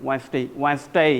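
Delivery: American day, today, every day, Wednesday with the ay tail cut short; teacher demoing American day; American accent. Shown as a demonstration. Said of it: "Americans ... थोड़ा सा कम कर देते हैं".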